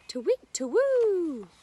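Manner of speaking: high, loud, slow owl hoot; teacher hooting like the owls for children. Pretend. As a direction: call out like an owl. Owl call after showing owls.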